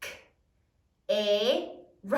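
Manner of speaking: ordinary talk, nothing marked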